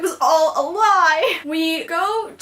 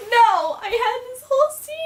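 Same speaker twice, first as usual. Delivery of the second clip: high-pitched, whiny retold protest; mock-distraught complaint; dismayed protest; 9 semitones higher than usual. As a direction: wail it like a let-down fan. Retells dismay at missed scene.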